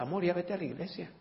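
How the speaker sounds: softly voiced, indulgent husband; worldly husband to wife. Worldly husband sending his wife to church, softly. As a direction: say it softly, letting the last word rise. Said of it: "hasta les dicen a la esposa".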